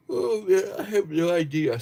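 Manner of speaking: talking through a big yawn. Real, not acted. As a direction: stretch the words out in a yawn. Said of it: "Sorry about the yawn".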